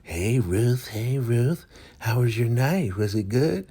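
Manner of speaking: high, nosy put-on voice; Joan. Nosy woman greets Ruth about her night, high prying voice. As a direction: pester in a lifted character voice. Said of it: "nosy ass Joan".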